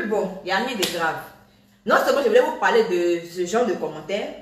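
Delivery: normal talking voice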